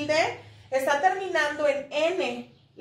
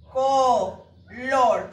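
"Color" split by a pause, CO, LOR; divided into syllables; 3 semitones lower, 4 dB louder than usual. Shown as a demonstration. Teacher showing it has dos sílabas, divided into syllables. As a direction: separate both syllables of "Color" firmly. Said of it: "Tiene dos sílabas".

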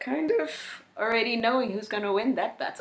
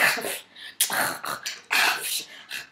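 breathy, helpless laughter; laughing. Real, not acted. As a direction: crack up at the memory. Laughing at the vet story.

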